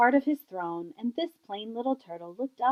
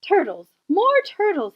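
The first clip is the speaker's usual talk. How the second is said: louder, rising bellow for more; 8 semitones higher, 7 dB louder than usual. Acted. King bellows for more turtles to stack up. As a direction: bray the order down the pond. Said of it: "he bellowed and brayed".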